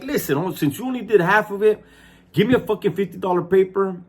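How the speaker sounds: high-pitched, put-on indignation; acting mad. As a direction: press a demand as if you're fed up. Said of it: "acts like he's mad".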